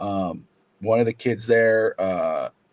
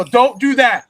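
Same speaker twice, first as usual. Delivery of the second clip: loud high "don't"; scolding coach; 11 semitones higher, 10 dB louder, slower than usual. Retold or imitated. Coach scolds his son, loud and high. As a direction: stop him firmly, voice up. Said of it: "I go".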